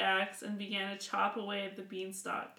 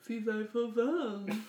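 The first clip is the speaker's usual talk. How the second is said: giant voice; slower than usual. Shown as a demonstration. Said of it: "Could you give every character an accent?".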